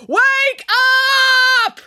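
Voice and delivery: high, loud drawn-out yell. A narrator's reading. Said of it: "while yelling".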